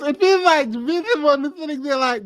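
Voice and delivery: usual speaking voice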